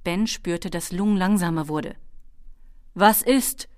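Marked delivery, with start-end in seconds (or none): 2.9-3.7 raised, higher dragon query; calling out. A narrator's reading. Dragon slows and checks on his riders, calling out. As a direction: call back to the riders. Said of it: "rief der Drache".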